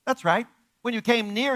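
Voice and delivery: usual speaking voice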